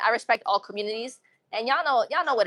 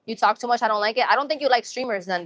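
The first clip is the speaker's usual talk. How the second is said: normal talking voice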